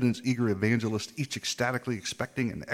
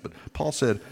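ordinary delivery

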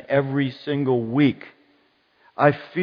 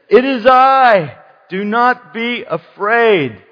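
high, loud, slow proclamation; commanding Jesus; 8 semitones higher, 7 dB louder, slower than usual. Acted. Preacher voicing Jesus to the frightened disciples, commanding. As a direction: declare it, stretching each word.

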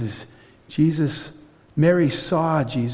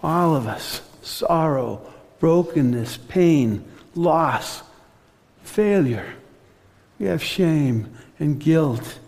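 ordinary talk

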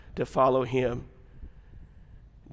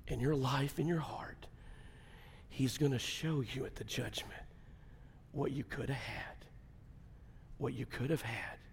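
very soft, grave warning with long pauses; hushed solemn preaching; 13 dB quieter than usual. Real, not acted. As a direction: bring it right down, near a whisper at times.

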